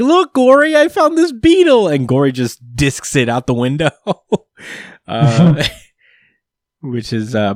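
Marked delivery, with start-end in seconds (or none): 0.0-1.9 put-on much higher and louder little-kid voice; a proud child showing off a beetle; excited kid Panda. Baby Panda calling to his brother, high and loud. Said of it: "He's like".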